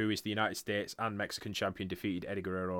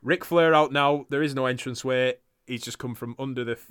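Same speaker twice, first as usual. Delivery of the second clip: normal talking voice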